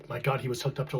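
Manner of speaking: usual speaking voice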